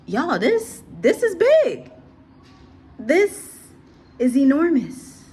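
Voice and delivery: high and loud on big and enormous; excited emphasis. Real, not acted. Vlogger warning viewers that the news is enormous. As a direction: sound the alarm to your viewers.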